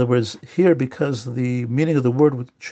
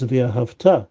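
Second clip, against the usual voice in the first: V'ahavta with the beat landing at the end; stress on final syllable. Shown as a demonstration. Teacher quoting the Shema, last syllable stressed in V'ahavta. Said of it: "the emphasis is on the future syllable".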